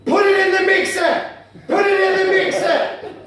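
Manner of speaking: loud, high yell; mock fan shout. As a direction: shout it from the stands. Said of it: "kept shouting".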